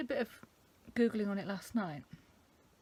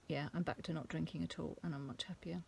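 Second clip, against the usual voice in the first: ordinary delivery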